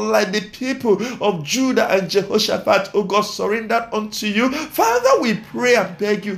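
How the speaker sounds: high-pitched, urgent pleading at the climax; impassioned prayer. Real, not acted. Preacher begging the Lord to have His way, impassioned prayer. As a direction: cry out each petition in rising, repeated bursts.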